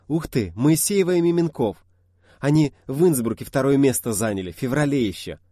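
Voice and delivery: loud and high delight; excited fan. Acted. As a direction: gush excitedly.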